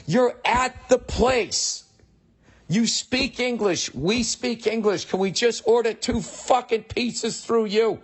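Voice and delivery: slow, very high, incredulous protest; exasperated. Retold or imitated. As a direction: spell it out slowly in high-pitched exasperation. Exasperated plea to order-taker.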